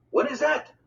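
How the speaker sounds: eager question. A narrator's reading. Boys pressing mother together.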